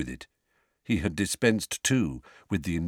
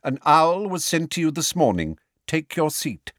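cold, raised-pitch official rebuke; 8 semitones higher, 3 dB louder than usual. A narrator's reading. Cold male voice ordering Harry to sit, cold. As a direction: order him down coldly, voice pitched up. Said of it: "A cold male voice".

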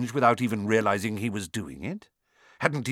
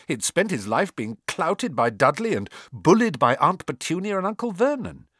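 plain reading voice

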